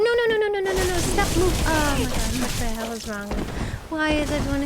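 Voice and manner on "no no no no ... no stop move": high, quick no's into a plea; panicked pleading. Real, not acted. Gamer getting hit, panicked high pleading. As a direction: beg the game to stop. Attacked during boss fight.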